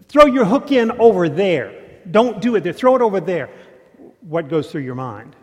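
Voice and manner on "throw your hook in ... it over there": loud, higher bossy holler from the bank. Retold or imitated. Guy on dry land hollers at a fisherman, loud and high. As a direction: yell directions across the water. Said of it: "is hollering out to you".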